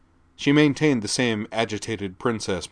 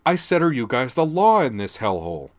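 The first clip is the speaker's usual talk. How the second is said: loud, disdainful snap, higher; snapping teen; 5 semitones higher, 6 dB louder than usual. A narrator's reading. Teen girl snapping at the police sergeant, disdainful. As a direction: demand it with teenage disdain. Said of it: "Laura snapped".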